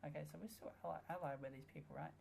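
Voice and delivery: ordinary delivery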